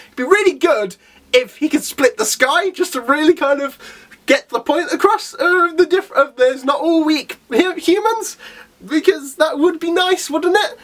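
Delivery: eager wish. Real, not acted. Wishing for a big hero moment.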